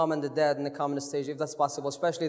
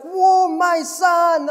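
high, loud, drawn-out whoop of pride; excited mom's cheer; 10 semitones higher, 10 dB louder, much slower than usual. Retold or imitated. Host imitates proud mom at the show, excited cheer. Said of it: "she was like".

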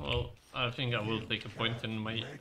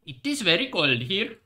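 higher, louder hammed-up Swedish accent; mock Swedish accent; 5 semitones higher, 4 dB louder than usual. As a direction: play a cheerful Swede selling furniture. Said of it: "Swedish is like".

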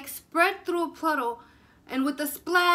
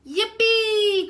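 much higher and louder, a gleeful whoop; cry of joy; 9 semitones higher, 9 dB louder than usual. Acted. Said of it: "cried Plank".

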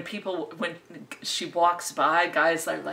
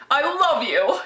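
swooning transatlantic "I love you", much higher and louder; mock transatlantic accent; 10 semitones higher, 6 dB louder than usual. Noir heroine declaring love, hammed-up transatlantic accent. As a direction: gush it like a 1940s screen siren with a transatlantic accent. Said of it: "the weird accent and".

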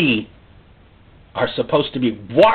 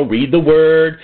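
ordinary delivery

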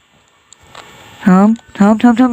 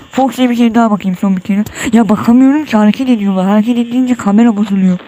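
usual speaking voice

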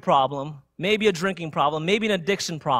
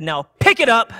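raised, louder angry command, imitated; berating; 5 semitones higher, 8 dB louder than usual. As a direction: order it like a fed-up driver. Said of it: "begins to berate me".

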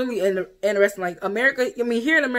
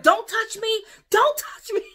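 indignant shout; 12 semitones higher than usual. Retold or imitated. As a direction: push them off with a high yell. Her own outburst retold.

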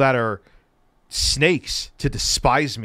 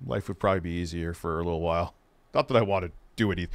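quiet aside; 7 semitones lower, 6 dB quieter, faster than usual. Real, not acted. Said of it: "I don't want to do anything to myself".

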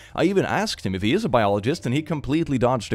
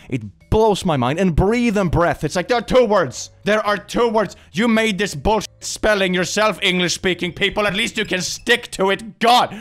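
pitched-up, mounting exasperation; exasperated rant; 8 semitones higher, 4 dB louder than usual. Real, not acted. Non-native speaker scolding English speakers about spelling, exasperated. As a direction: vent it higher, ending on a loud burst.

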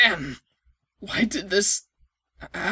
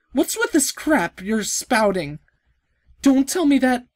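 ordinary talk, nothing marked

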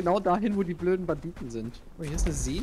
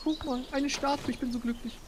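cute villager voice; 8 semitones higher, 4 dB quieter than usual. Pretend. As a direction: squeak it like a happy villager. Voicing an NPC villager.

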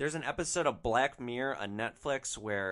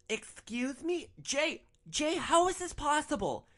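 much higher, whiny, outraged mock voice; mock spoiled girlfriend; slower than usual. Driver imitating Natalie pleading to her boyfriend Jay, high whiny mock outrage. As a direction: squeal it like a spoiled rich girl in disbelief.

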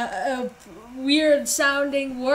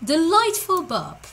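comically British, pitched up; British accent; 6 semitones higher than usual. Pretend. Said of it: "Did I just do a British accent?".

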